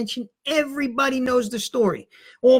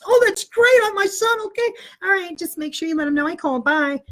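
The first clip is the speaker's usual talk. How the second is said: thrilled mom; 7 semitones higher than usual. Retold or imitated. Mom hears good news from teacher, delighted. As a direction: gush with relief.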